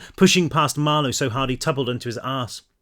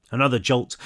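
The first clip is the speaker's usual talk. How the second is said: ordinary delivery, nothing marked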